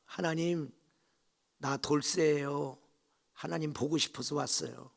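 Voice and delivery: lightly voiced humble believer. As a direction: speak softly to God, as a devoted visitor. 돌쇠가 하나님께 아뢰는 말. (낮고 순박하게 아뢰는 말투)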